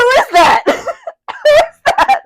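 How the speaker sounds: very high, loud and stretched out; incredulous laughing shriek. Real, not acted. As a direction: squeal the question through laughter. Joins in mock shock at picture.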